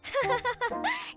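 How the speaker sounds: high, light giggle; high-pitched playful chuckle; teasing laugh. Acted. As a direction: laugh it off as a joke.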